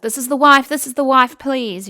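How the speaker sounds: retold insistent call for attention; urgent plea.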